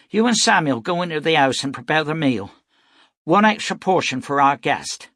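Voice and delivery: tough frontier mother. A narrator's reading. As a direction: send the children in, fast and firm. Beth orders the children inside.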